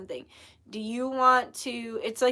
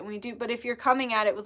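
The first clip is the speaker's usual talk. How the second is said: ordinary delivery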